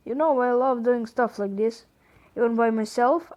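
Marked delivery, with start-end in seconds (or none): none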